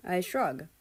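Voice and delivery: plain reading voice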